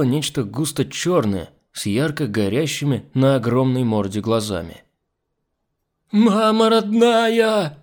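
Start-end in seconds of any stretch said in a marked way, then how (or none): 6.1-7.8 very slow, high, loud wail; shrieking squeal. A narrator's reading. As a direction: squeal it out in terror, stretching every vowel. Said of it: "заезжала Нюша". (протяжный истошный визг)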